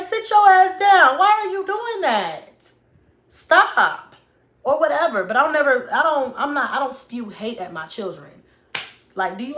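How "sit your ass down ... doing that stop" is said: loud, high, drawn-out telling-off; acted exasperated yell, stretching the words; scolding mom. Mom ordering her kid to sit down, scolding shout.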